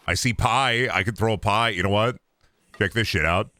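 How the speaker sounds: ordinary talk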